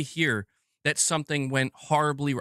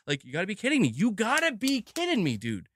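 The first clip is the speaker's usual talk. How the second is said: exasperated disbelief; 7 semitones higher than usual. Real, not acted. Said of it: "crash out I'm experiencing".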